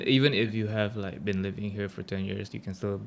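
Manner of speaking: ordinary talk, nothing marked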